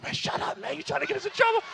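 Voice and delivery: loud hissed whisper breaking into high voice; panicked hushing. Retold or imitated. Dad scared mom will hear, frantic hushing. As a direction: frantically shush him, then squeak upward.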